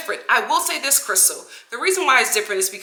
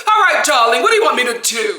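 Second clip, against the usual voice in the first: louder mock-brisk imitation; tearless comeback; 5 dB louder, faster than usual. Host mimics Dorit once Kyle raised her voice, tearless comeback. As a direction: answer loudly, all tears suddenly gone. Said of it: "She dropped that cry voice so quick".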